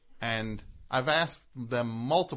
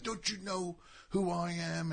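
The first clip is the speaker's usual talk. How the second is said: higher, haughty mock English accent; indignant English; 7 semitones higher, 6 dB quieter than usual. Actor scoffing at the producer in a mock English accent. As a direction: puff up like an offended English star. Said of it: "he'll be like".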